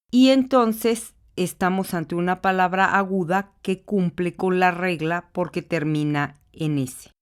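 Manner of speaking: ordinary delivery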